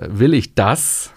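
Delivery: slow question with a stressed, rising "das". Shown as a demonstration. Coach asking about consequences of avoiding doctors, stress on "das". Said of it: "Das wäre die zweite Betonung".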